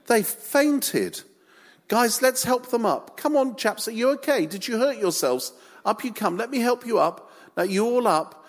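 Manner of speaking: high-pitched, fussing helper; playfully imagined Jesus. Acted. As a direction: coax them up with mock concern. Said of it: "Jesus saying".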